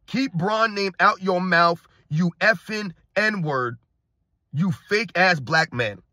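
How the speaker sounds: slow, drawn-out reading of an insult; slow mock read-out. Host reading a fanatic's angry message, slow deliberate mock read-out. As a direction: spell out the message word by word, with heavy pauses. Rereads hostile fan message slowly.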